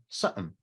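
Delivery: short, soft "something" without r or qim; dropped r and qim. Retold or imitated. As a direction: clip "something" down, skipping r and qim. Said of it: "they drop the qim, and they just say".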